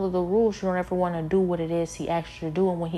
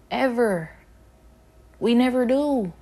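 higher and louder, hammered home; emphatic; 6 semitones higher, 6 dB louder than usual. Real, not acted. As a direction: drive the point in hard. Emphatic sermon point on disobedience.

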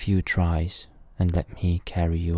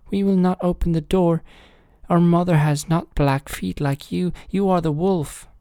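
put-on high, loud little kid voices; kids crying out; 11 semitones higher, 5 dB louder than usual. A narrator's reading. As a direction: cry out high as the frightened-off kids. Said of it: "saw them and cried".

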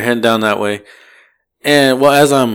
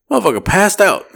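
ordinary talk, nothing marked

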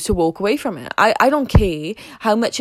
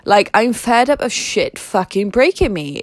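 normal talking voice

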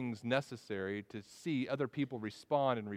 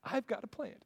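put-on much higher, thin childlike voice of giddy delight; giddy Jonah; 9 semitones higher, 7 dB quieter than usual. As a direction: squeal it like a kid with a new toy. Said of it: "deliriously happy".

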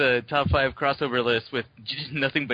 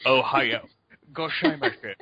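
slurred Scottish take on Japanese; Sean Connery. Retold or imitated. Co-host mocks actor's Japanese disguise, Scottish-accented Japanese. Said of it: "trying to do a Japanese accent".

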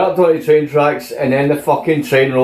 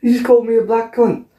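mock indignation; 8 semitones higher, faster than usual. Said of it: "He's like".

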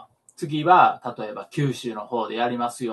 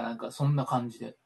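ordinary talk, nothing marked

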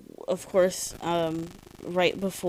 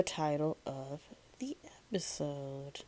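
whispering; 2 semitones lower, 11 dB quieter than usual. Real, not acted. Confessing she forgot the title.

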